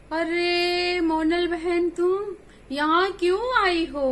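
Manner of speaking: very lovingly. Acted. Wicked bear greeting the mother goat, sugary sing-song. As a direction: lay on the fake sweetness, drawn out. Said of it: "बड़े प्यार से कहने लगा".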